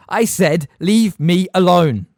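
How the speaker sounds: loud, slow angry shout; boy shouting at a pestering ghost. A narrator's reading. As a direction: bellow it at him. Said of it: "Harry shouted".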